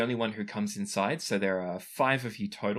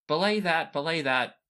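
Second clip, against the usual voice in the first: raised, higher commanding voice; hurried, insistent countermand; brisk command; 7 semitones higher, 5 dB louder than usual. Acted. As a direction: cut in with an urgent order. Max hurrying in, countermanding the guards.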